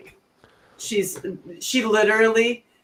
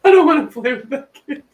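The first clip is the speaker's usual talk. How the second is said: put-on laughing kid refusal; 7 semitones higher, 9 dB louder than usual. Voicing daughter's refusal, laughing.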